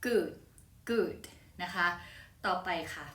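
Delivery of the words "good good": "good" with a brief "oo"; short vowel example. Shown as a demonstration. Teacher giving the example word, "good" said carefully with short vowel. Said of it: "ตัวนั้นก็คือ".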